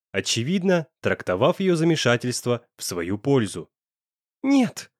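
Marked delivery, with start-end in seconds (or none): 4.4-4.9 high, desperate cry of no; frantic refusal. A narrator's reading. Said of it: "Отчаянно завертела головой".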